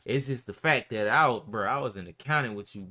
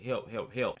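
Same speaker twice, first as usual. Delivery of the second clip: usual speaking voice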